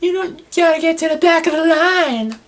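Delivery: mock her as a smug complainer; high smug whining impression; whiny Charlotte. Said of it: "bitching and complaining, like".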